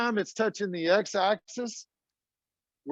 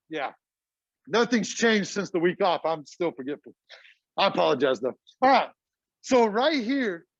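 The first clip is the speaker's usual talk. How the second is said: ordinary delivery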